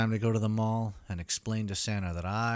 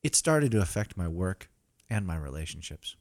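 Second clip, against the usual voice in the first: normal talking voice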